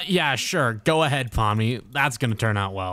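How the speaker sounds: low, dismissive sarcasm; sarcastic. Acted. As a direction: drop way down, drily unimpressed. Husband sarcastically dismisses her plan.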